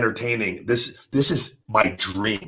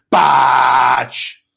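screamed Botch; 8 dB louder than usual. Shown as a demonstration. Screaming Botch on TV after a mistake. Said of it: "just scream".

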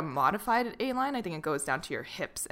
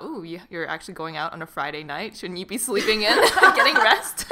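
teasing; 8 dB louder than usual. Retold or imitated. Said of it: "Asking Mel about".

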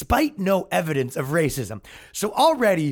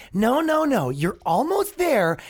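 jumps high on the first no, then slows down; mock patient correction; 7 semitones higher, slower than usual. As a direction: gently talk them down like a teacher. Correcting woke writers, mock patient.

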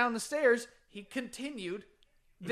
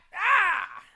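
high, loud cry of reaction; loud whoop; 12 semitones higher, 9 dB louder than usual. Real, not acted. Co-host reacting to the naked confession, loud cry. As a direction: let out a big high "ah".

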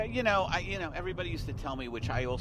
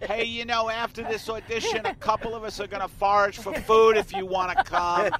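much higher, louder call out to an unseen voice; yelling to the booth; 8 semitones higher, 8 dB louder than usual. Retold or imitated. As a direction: shout up to the casting booth, eager and lonely. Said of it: "I'd be yelling".

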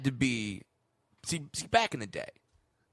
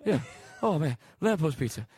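out of breath sweaty guy; winded fat guy. Acted. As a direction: puff between words like a slob. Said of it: "I'm getting winded talking like a fat guy".

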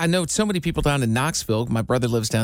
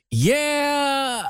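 "Yeah" held long and much higher; drawn-out "Yeah"; 11 semitones higher than usual. Shown as a demonstration. Showing own crutch when asked about weekend plans, drawn-out "Yeah". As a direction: stretch "Yeah" high before answering. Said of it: "You, like, draw it out".

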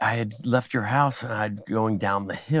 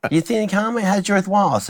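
higher, lightly mocking Jay voice; imitating Jay; 8 semitones higher than usual. Jay greets the comic in a higher mock voice. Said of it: "And I go".

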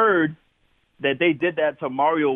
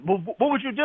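high mock voice; 11 semitones higher, faster than usual. Coaches push back, retold.

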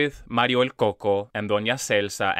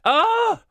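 sudden high shriek; disgusted shriek; 7 dB louder than usual. Real, not acted. Reacting to bowl of piss.

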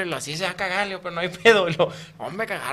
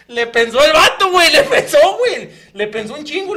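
laughing retelling; 9 semitones higher, 12 dB louder than usual. Real, not acted. Laughing at the tense moment.

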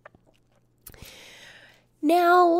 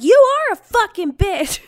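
high, loud defiant yell; hammed-up retort; mock yell; 9 semitones higher, 8 dB louder than usual. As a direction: shout it back. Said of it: "I'm like".

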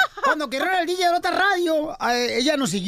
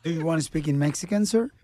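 low, put-on polite English voice; deep-voiced American stranger act; English-speaking stranger; 9 semitones lower, 4 dB quieter than usual. Prankster plays English-speaking man. (voz grave de gringo fingido)

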